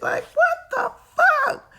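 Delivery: high squeal of laughing disbelief. Real, not acted. Mother baffled by messy kids, laughing squeal. Exasperated over kids not cleaning.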